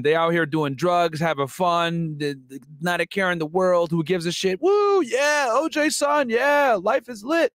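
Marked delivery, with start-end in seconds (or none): 4.6-7.5 mock party cheer. Mocking partying celebrity kid.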